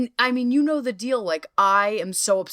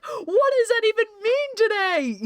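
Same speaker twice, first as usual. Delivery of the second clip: much higher, baffled inner voice; a squeaky, baffled self-question; bewildered high question. Retold or imitated. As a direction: ask it like you truly can't fathom it.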